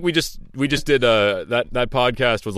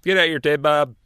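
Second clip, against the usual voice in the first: high twangy holler; 6 semitones higher than usual. Pretend. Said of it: "comes from a trailer park".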